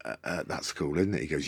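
ordinary talk

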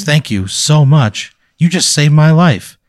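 high, loud and slowed, gushing gratitude; overwhelmed thanks. Acted. Boxer thanking the stranger who saved his life, overwhelmed and loud. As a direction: pour out thanks like you just cheated death.

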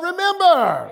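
high preacher's call to shout; rallying shout. Shown as a demonstration. Preacher telling somebody to shout remember, rallying shout. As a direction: lead the crowd's shout, long last word. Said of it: "Somebody shout".

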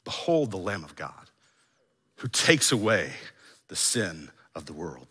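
soft, low, with pauses; hushed declaration. Acted. Repeating what the man in the rafters heard, hushed declaration. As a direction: let it sink away quietly, phrase by phrase.

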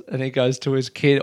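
usual speaking voice

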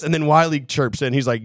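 ordinary talk, nothing marked

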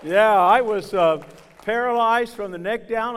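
loud, high preaching shout; full-force preaching. Acted. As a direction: preach it out to the whole church at full volume.

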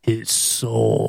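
low, hushed ominous voice; mock-menacing, drop it deep; Undertaker impression. Echoing Undertaker's ominous promo voice.

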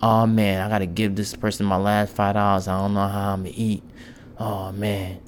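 worried grumble. Acted. Preacher mimicking a reluctant giver down to the last five dollars, worried. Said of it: "Who gives worryingly?".

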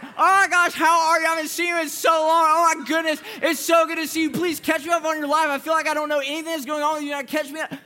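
put-on high, loud gushing girl voice; hammed-up squealing catch-up; voice up an octave. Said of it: "the pitch of your voice goes up an octave".

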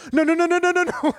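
high, loud string of no's; mock backtracking in a shrill rush; retold rapid-fire denial. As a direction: squeal the no's out in a rapid run.

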